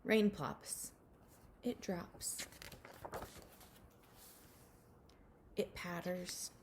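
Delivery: slow, hushed storybook reading with whispered lines; reading a picture book to children. Acted. Teacher reading a rain book to her class, hushed storybook voice. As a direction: read it gently to little ones, letting some lines fall to a whisper.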